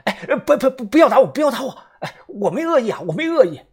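high, loud panicked begging; frightened pleading. A narrator's reading. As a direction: plead for mercy as the stick swings. Stranger begs not to be hit.

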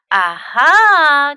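loud, high aha; triumphant cry. A narrator's reading. Mom discovers hiding Fox, loud exclaimed aha. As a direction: cry out as if catching someone. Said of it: "cried Mom".

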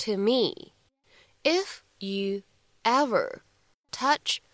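very slow, separated words with pauses; slow it right down, phrase by phrase. Shown as a demonstration. Teacher modelling the English dialogue for learners, word by word.

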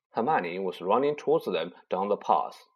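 plain reading voice

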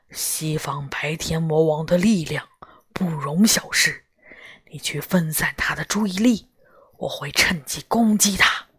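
hushed, urgent whisper; low voice. Acted. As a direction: whisper the plan so he can't hear. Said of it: "高公低声对我说".

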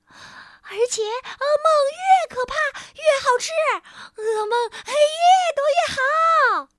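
high shouting creature voice; excited sprite shout. A narrator's reading. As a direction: yell with greedy glee. Sprite raves about nightmares. (兴奋大喊的小精灵)

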